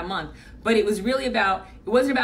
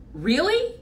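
surprised; 7 semitones higher than usual. Retold or imitated. Told of sixty hours a week.

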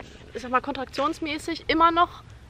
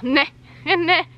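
laughing refusal; 5 semitones higher, 7 dB louder than usual. Retold or imitated.

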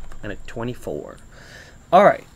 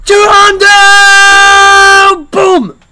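screaming with joy, much higher; ecstatic shout; 17 dB louder, much slower than usual. Real, not acted. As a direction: belt out the win. Wins two hundred.

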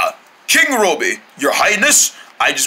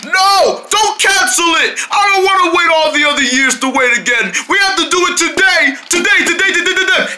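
insistent shouting; 8 semitones higher than usual. Acted. King shouting at helpers not to cancel the speech. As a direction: demand it, almost a tantrum.

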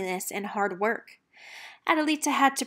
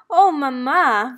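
slow, much louder gushing daughters; twittering; 3 semitones higher, 10 dB louder, slower than usual. A narrator's reading. Two daughters squeal to their mother, loud and slow. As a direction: daughters gush over the news, loud and drawn out. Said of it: "Valentina and Dulce twittered".

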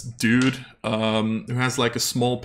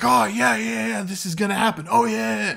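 excited onlookers; 8 semitones higher than usual. Acted. NPCs eager about the duel.

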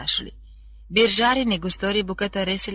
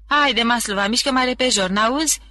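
loud and raised, hurrying her; head guard calling sharply; impatient shout; 5 semitones higher, 5 dB louder than usual. A narrator's reading. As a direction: bark through the cell door to get her moving. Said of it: "strigă gardianul șef".

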